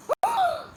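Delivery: sudden high gasp. Real, not acted. Gasping at a shock in the challenge. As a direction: gasp in surprise.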